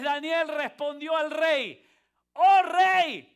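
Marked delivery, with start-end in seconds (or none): 2.3-3.3 hailing the king. A narrator's reading. Daniel hails the king from den.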